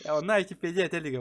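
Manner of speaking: ordinary talk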